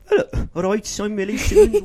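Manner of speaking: high, Peaky Blinders Brummie voice; Birmingham accent. Retold or imitated. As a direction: mimic a Brummie intro. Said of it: "her Peaky Blinders".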